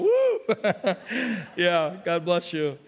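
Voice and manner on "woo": high, loud woo; playful high-pitched "woo"; imitated whoop. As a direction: mimic a listener's excited whoop. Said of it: "Did somebody just go".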